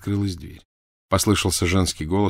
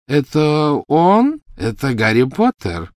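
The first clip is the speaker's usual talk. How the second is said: slow, high and loud questions; eager agitation; 7 semitones higher, 6 dB louder, slower than usual. A narrator's reading. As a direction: stretch each question with avid excitement. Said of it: "такого волнения в голосе Люциуса". (взволнованно, с жадным нетерпением)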